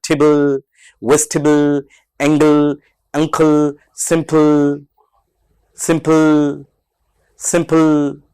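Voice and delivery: the el at the end of टेबल and सिंपल drawn out; stretched final le. Shown as a demonstration. Teacher shows students how to stretch final le. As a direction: stretch only the final l sound. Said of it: "सिर्फ last में एलई को stretch".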